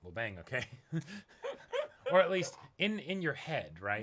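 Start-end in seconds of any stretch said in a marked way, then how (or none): 0.7-2.1 a high giggling laugh; high giggle. Real, not acted. Co-host laughs at the sudden romance, high giggle. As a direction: giggle at the idea.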